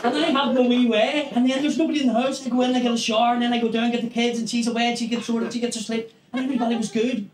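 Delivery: ordinary delivery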